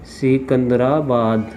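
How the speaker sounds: Secunderabad drawn out slowly, syllable by syllable; slow dictation. Real, not acted. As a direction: stretch out Secunderabad as if writing it. Spelling out city while writing. (Secunderabad को धीरे-धीरे खींचकर बोलना)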